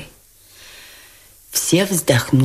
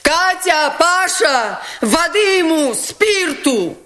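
calling out; 12 semitones higher, slower than usual. A narrator's reading. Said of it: "крикнула Настасья Филипповна".

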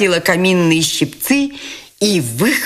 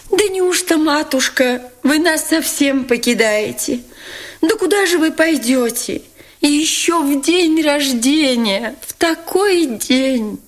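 tearful pleading; 10 semitones higher than usual. A narrator's reading. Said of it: "спрашивали расплакавшиеся".

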